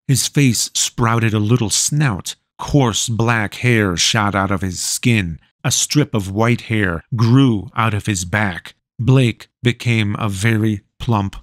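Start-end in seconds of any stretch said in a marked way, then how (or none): none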